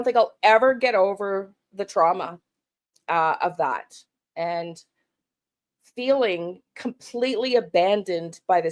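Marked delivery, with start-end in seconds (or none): none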